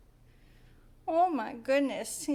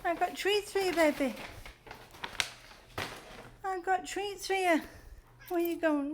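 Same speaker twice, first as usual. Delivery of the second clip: high-pitched, cutesy coaxing; sweet talk to a pet, pitched up; 6 semitones higher than usual. Real, not acted. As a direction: lure the cat with treats, voice up high. Coaxing the cat with treats.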